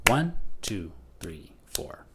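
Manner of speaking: one voiced, three four whispered; counting in four. Shown as a demonstration. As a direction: stress one, whisper the others. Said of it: "one stressed beat followed by three unstressed".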